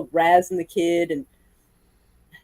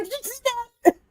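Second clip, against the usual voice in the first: excited; 5 dB quieter, faster than usual. Retold or imitated. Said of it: "He's like".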